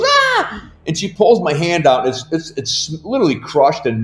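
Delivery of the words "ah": very high mock shriek; mother's shriek. Mom realizing she slammed her son's hand, mimicked high shriek. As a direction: imitate a startled mom's short high scream. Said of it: "And she goes".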